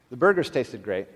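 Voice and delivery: usual speaking voice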